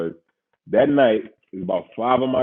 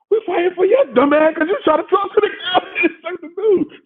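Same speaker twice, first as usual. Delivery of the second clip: laughing scold; 3 dB louder than usual. Retold or imitated. Friends scolding, teller laughing.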